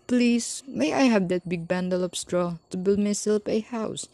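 high squeaky piglet voice; sweet, polite little pig asking. A narrator's reading. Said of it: "asked the pig".

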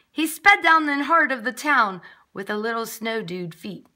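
usual speaking voice

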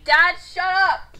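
loud, slow annoyed yell; annoyed shout. Real, not acted. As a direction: snap it at Dad loudly. Yelling at her dad.

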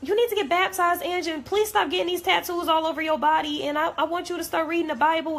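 a churchgoer nagging her friend, mimicked high; imitating churchy Queen. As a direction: play the devout friend urging church. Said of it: "all about".

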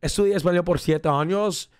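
speaking with a Spanish accent. Shown as a demonstration. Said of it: "this is how I start every Spanish conversation".

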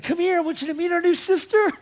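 high, loud, elated re-enactment; excited calling out. Retold or imitated. Speaker introducing the newly born-again sister-in-law, excited calling. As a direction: call someone over in a high, excited voice. Retold joyful introduction of new convert.

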